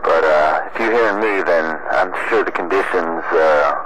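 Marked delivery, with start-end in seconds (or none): none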